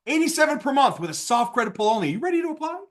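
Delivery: "ready to apply" lifted high into a question; inflection on "ready to apply". Shown as a demonstration. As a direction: raise the voice on "You ready to apply?". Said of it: "Little inflection in my voice".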